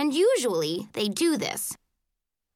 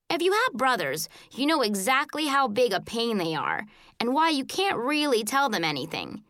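plain reading voice

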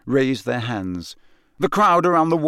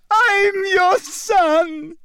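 very high, even slower anguished scream; screaming son; 5 dB louder, much slower than usual. A narrator's reading. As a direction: drag it out in despair at his father. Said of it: "he screamed up at Crouch".

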